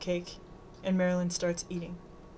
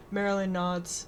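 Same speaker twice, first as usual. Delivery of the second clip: ordinary delivery, nothing marked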